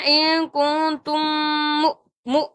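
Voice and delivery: ان کن تم with long nasal hums, then short مو; nasal holds, merged meem. Shown as a demonstration. Teacher models for students, held nasals in ان کن تم. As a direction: hum through ان کن تم, cut مو short. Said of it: "ہم نے پڑھنا".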